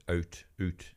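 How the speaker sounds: Canadian vowel in "out"; Canadian accent. Retold or imitated. Showing how Canadians give themselves away, Canadian accent. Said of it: "reveal their Canadianness when they say out".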